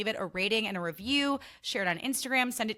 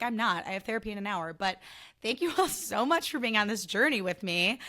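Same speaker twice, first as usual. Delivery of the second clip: normal talking voice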